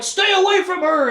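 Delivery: high, loud protective yell; mock warning shout. Wizard warns off witch, urgent mock shout. Imitating Zed defending with a threat.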